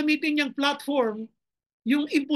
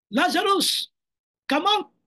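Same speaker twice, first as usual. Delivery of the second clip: commanding call; 4 semitones higher, 4 dB louder, slower than usual. Acted. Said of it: "sinigawan niya si Lazaro".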